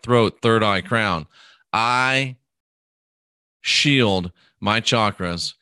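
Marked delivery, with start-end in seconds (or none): 1.7-5.5 slow, deliberate affirmation, each word placed. Shown as a demonstration. Demonstrating the shielding words to a listener, slow and calm. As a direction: say it slowly like a calm vow. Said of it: "That's the way I would do it".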